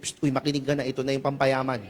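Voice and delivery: hushed, low secret tip; conspiratorial whisper-like. Pretend. To the seatmate, passing on a money tip quietly. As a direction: lean in and share a secret quietly.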